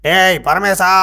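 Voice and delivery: loud, high-pitched call; alarmed shout. Acted. Sage suspecting his disciple fled, alarmed shout. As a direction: yell the missing disciple's name.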